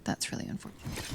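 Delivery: breathy whispered aside; whispering. Real, not acted. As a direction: mutter it under your breath. Quiet aside after a misclick.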